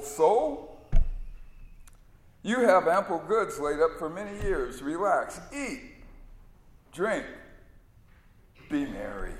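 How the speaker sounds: self-satisfied, leisurely; a rich man coaxing himself to relax; lightly voiced smug rich fool; rich fool speaking to his soul. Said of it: "listen carefully to the wording in Jesus' parable".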